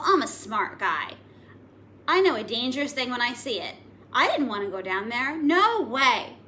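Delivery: lively read-aloud storytelling to a class; story narration. A narrator's reading. Teacher reading the giant's tale aloud to the class, lively storytelling. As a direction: tell it like a grumpy giant to children.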